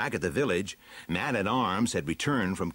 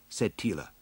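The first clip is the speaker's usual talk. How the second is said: ordinary delivery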